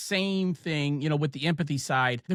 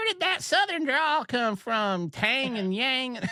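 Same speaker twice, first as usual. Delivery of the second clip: teasing Southern drawl, higher and slow; mocking Southern drawl; 9 semitones higher, slower than usual. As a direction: imitate schoolkids mocking a drawl. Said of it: "the kids at school would be like".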